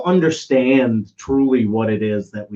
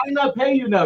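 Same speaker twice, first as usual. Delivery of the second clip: much higher mock refusal; Greek owner; 10 semitones higher than usual. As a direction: refuse to pay, indignant. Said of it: "I said".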